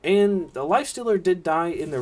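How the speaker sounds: keyed-up caster running through each hit; live play-by-play. Real, not acted. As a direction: call the team fight as it unfolds. Live play-by-play of team fight.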